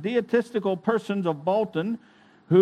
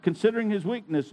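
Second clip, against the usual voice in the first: plain reading voice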